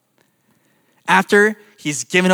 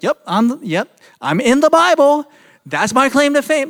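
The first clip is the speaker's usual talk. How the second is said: mock sheepish sinner; 7 semitones higher than usual. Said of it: "And like".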